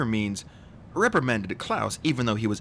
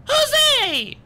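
put-on piercing high baby shriek; very loud, high squeal; 10 dB louder than usual. A narrator's reading. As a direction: shriek it at the top of the range. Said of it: "Sonny shrieked".

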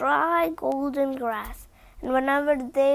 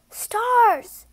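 high, loud burst of wonder; excited exclamation; 9 semitones higher, 6 dB louder than usual. A narrator's reading. Lion exclaiming at the giraffe's starry coat, high and loud. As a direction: cry it out in amazement. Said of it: "he exclaimed".

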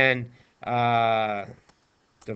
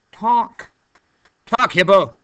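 playful command; 5 semitones higher, 6 dB louder, slower than usual. Real, not acted. Ordering the hippo to talk.

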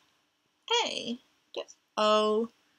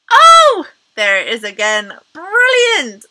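loud high surprised shout; excited surprise; 4 semitones higher, 14 dB louder than usual. Acted. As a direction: burst out in mock surprise. Game reveal.

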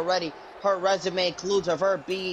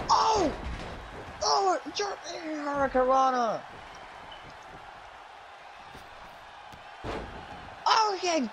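much higher, shouted reaction; excited shout; 9 semitones higher than usual. Real, not acted. Commentator reacting to a hurricanrana, shouting. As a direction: holler at the big move. Calling big moves.

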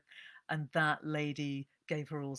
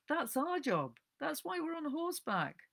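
much higher, bright insistent voice; Marjorie insisting; 12 semitones higher than usual. Acted. Librarian insisting to Nancy it is their job, high and bright. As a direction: press the point cheerfully.

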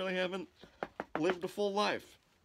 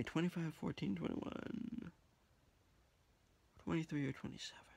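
whispering; 9 dB quieter than usual. Real, not acted. Whispering numbers while scratching.

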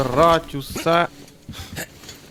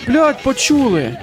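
startled curse; 8 semitones higher, much slower than usual. Real, not acted. Spotted by enemies in game.

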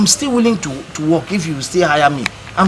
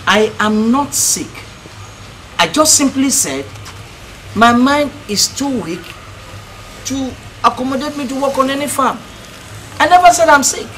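higher, emphatic protest; indignant denial; 6 semitones higher than usual. Acted. Man correcting a woman who asked if he's sick, indignant. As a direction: insist firmly, offended at being misunderstood.

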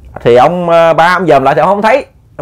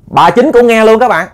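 ordinary delivery, nothing marked

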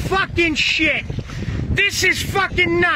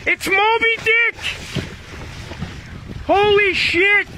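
excited shouting; 5 semitones higher than usual. Real, not acted. Fisherman shouting to his buddy over the huge catch, thrilled yell. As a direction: shout it out, stunned by the size of the catch.

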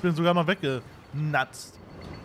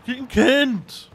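self-mocking; 5 semitones higher, 5 dB louder, much slower than usual. Real, not acted. Mocking own play.

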